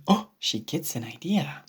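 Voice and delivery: usual speaking voice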